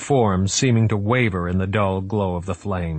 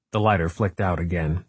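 plain reading voice

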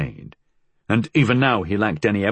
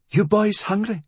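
much higher-pitched motherly question; mother's voice; 12 semitones higher than usual. A narrator's reading. Mother asking her sons at the screen door, high motherly voice. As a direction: voice a mother checking on her boys.

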